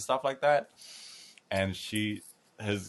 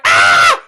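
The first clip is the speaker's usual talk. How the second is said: imitated scream; 3 semitones lower, 18 dB louder than usual. Grandma screams at stranger.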